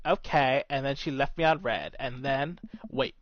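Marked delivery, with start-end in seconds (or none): 0.0-0.6 curt okay. Retold or imitated. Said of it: "And I was like".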